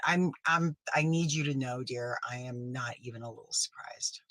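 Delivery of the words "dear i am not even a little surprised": whisper. Acted. Smug aside to betraying fan.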